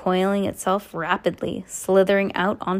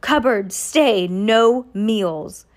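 choked, louder furious bursts; strangled voice; 4 dB louder, much slower than usual. A narrator's reading. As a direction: spit out short furious orders. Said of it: "so angry that he could hardly speak".

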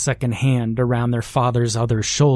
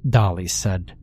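ordinary delivery, nothing marked

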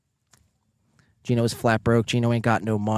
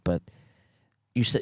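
ordinary delivery

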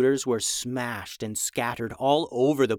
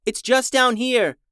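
raised, higher call; 8 semitones higher, 4 dB louder than usual. A narrator's reading. Guide to students running down the hallway, calling out. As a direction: call it ahead to a running group.

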